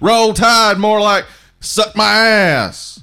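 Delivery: mock Alabama cheer. Mocking Alabama chant.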